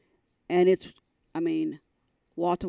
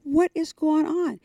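upset, in tears; 10 semitones higher, 5 dB louder, slower than usual. Retold or imitated. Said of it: "I'm crying".

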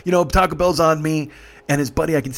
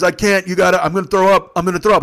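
urgent warning; 4 semitones higher, 6 dB louder than usual. Retold or imitated. Queasy passenger urgently warns driver.

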